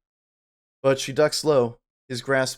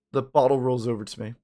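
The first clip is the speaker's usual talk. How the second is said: ordinary talk, nothing marked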